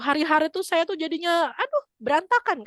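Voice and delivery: high, fretful voice of a cheated mother; upset woman. Retold or imitated. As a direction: whine it high like an aggrieved lady.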